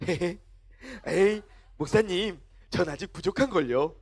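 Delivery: low, coy giggling humility; mock modesty. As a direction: play bashfully modest with a little laugh. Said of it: "겸손하기까지 해".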